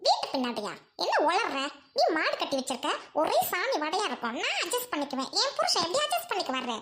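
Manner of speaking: haughty sister scolding at her gate; snobbish rich sister. Acted. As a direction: talk down to the noisy crowd at the gate. Sister scorns dung-filled yard.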